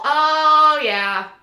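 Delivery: loud, high, drawn-out oh yeah; big teasing exclamation; gleeful reveal. Pretend. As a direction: whoop it with knowing relish.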